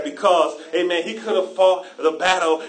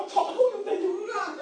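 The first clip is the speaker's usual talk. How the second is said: mock anger; 14 semitones higher, 3 dB quieter than usual. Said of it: "I got mad".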